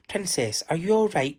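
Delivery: worried cry. Acted. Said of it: "cried one of the men".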